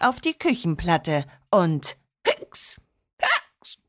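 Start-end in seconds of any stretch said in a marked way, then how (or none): 2.2-3.8 put-on drunken hiccups.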